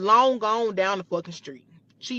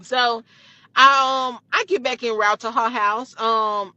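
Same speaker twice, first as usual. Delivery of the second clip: ordinary talk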